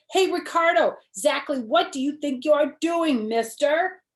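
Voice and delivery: high and loud, scolding; indignant. Acted. Girl scolding her boyfriend for chasing someone else, indignant. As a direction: demand an answer from him.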